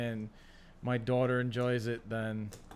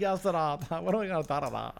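imitating TikTok clip; 4 semitones higher than usual. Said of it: "that stupid TikTok clip".

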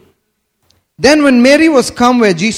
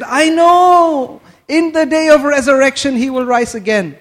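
insistent reply; 6 semitones higher than usual. Retold or imitated. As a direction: protest that you already know, voice lifted. Voicing Martha's reply to Jesus.

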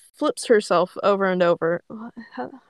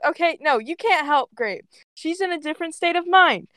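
pitched-up, insistent character voice; urgent warning; 7 semitones higher than usual. Acted. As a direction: plead firmly, slowing on the final command. Ember urgently ordering Ash away.

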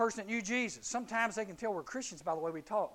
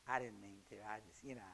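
much lower, softer mumbling excuse; sheepish teen; 10 semitones lower, 12 dB quieter than usual. Retold or imitated. Caught teen excusing himself at the locker, low and sheepish. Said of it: "somebody come up ... and go".

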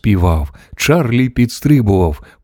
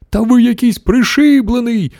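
put-on high, outraged old-lady outcry; scandalised grandmother squeal; indignant exclamation; 12 semitones higher than usual. A narrator's reading. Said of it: "вигукнула бабуся Джозефіна".

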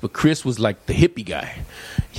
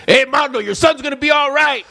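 much higher, louder shout; yelled from a car; 14 semitones higher, 5 dB louder than usual. Retold or imitated. Stranger yelling from a passing Volkswagen, loud shout. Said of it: "Some guy yells out".

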